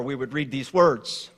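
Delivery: ordinary talk, nothing marked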